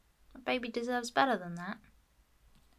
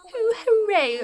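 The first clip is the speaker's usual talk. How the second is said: high, loud burst of joy; excited hooray; 12 semitones higher, 12 dB louder than usual. Pretend. Player voicing Blathers finding a new fossil, high and loud. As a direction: shout it like a thrilled owl.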